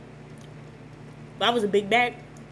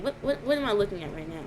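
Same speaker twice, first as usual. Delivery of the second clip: ordinary delivery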